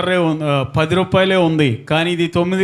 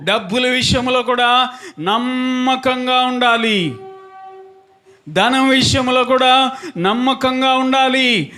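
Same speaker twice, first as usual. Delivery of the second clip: high, ringing sermon delivery on a held note; preaching; 8 semitones higher, slower than usual. Real, not acted. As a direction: preach it out, stretching phrase endings. Preaches to congregation.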